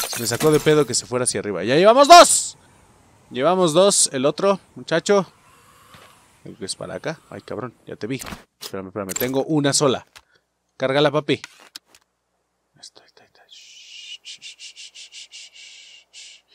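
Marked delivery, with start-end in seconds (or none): 1.6-2.6 loud, high and triumphant; excited loud cheer; triumphant shout. Real, not acted. Celebrates a kill. 12.7-16.4 hushed whispered shushing; stealthy whisper. Real, not acted. Sneaking up on an enemy.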